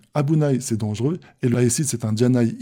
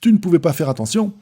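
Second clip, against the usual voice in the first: quick, raised, louder scolding; reproachful; 6 semitones higher, 5 dB louder, faster than usual. Acted. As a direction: scold someone for not being careful. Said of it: "indique un ton de reproche". (ton de reproche, vif et aigu)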